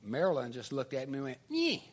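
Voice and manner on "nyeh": high, short "nyeh"; mock taunting noise. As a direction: imitate a smug schoolgirl's taunt. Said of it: "like that".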